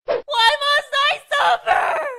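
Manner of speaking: slow, high, loud mock anguish; hammed-up slow cry of suffering; anguished wail. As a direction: wail it to the heavens, dragged out.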